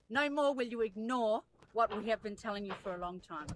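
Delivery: ordinary talk, nothing marked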